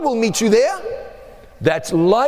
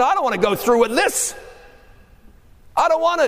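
anguished cry; 6 semitones higher than usual. Acted. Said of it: "he's crying out to his Father".

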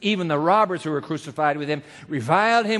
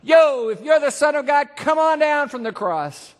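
mocking passerby; 7 semitones higher, 4 dB louder than usual. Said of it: "wagging their heads and saying".

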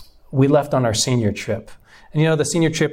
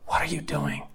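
hushed, much softer final plea; whisper; 8 dB quieter than usual. Retold or imitated. God's voice to the son, hushed whisper. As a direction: let it fall to a whisper. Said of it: "And God spoke to me".

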